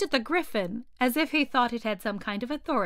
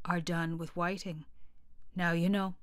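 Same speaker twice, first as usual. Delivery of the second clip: deep voice; 4 semitones lower, 6 dB quieter than usual. A narrator's reading. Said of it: "in a deep voice".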